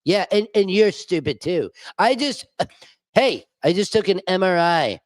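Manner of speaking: mocking impression of a boastful, dozy politician; Donald Trump. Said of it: "now we have Dozin Donnie".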